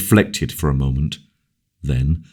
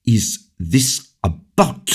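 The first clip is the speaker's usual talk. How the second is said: with authority; 7 semitones higher, slower than usual. A narrator's reading. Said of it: "said the king with authority".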